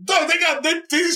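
ordinary talk

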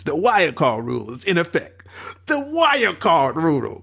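Michael Cole's cadence. Retold or imitated. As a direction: stretch each word like a ringside commentator. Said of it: "saying in his cadence".

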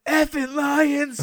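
high, drawn-out mock curse at the team; imitating a fed-up fan howling at the screen; disgusted fan yell. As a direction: stretch it into a slow, high holler. Acting out fan cursing team.

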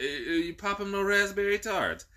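high, slow hammed-up British accent; mock British accent. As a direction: prance through it in a fake British accent. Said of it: "if you come back sounding British".

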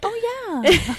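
high, drawn-out dawning agreement; exaggerated high-pitched recognition; mock realization. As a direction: act out a sudden 'that's so us'. Acting out their reaction to horoscope.

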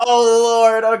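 high, loud exasperated outburst; real exasperation. Real, not acted. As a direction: wail it in comic dismay. Told she must find another wording.

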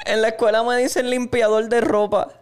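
high-pitched mock crying kid; hammed-up tearful child voice; crying little boy. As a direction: wail to mom like a child. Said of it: "pero de que llorando, llorando".

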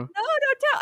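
squeaky high plea; childish plea. Pretend. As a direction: whine it high like a kid. Begging not to be told on.

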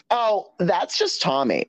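high, drawn-out dismissive call; calling across room. Retold or imitated. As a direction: imitate a friend piping up from afar. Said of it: "A J from across the room goes".

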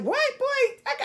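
put-on high, startled what boy; shocked brush-off. Teasing young man's pickup line, high surprised. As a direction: squeak it out in mock disbelief. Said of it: "I was like".

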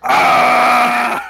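long loud high yell of pain; orc scream. Acted. Clawed orc cries out, loud scream. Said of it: "the orc goes".

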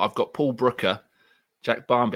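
ordinary delivery

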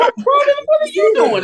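high, loud incredulous soap voice; mock soap actress. Soap star scolding her co-star, high mock outrage. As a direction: play the shocked soap diva. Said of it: "Robin Strauss is like".

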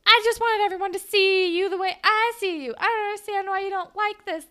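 a lightly voiced girlfriend doubling down; leaning into it. Girlfriend provoking her boyfriend, mildly mocking high voice. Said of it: "leans into it and is like".

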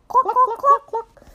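very high, loud squawky clucks; high-pitched storybook chicken; chicken clucking. A narrator's reading. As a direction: cluck like an alarmed hen. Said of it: "said Chicken".

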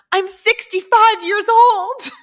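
mock indignant. Mimicking mom's protest text.

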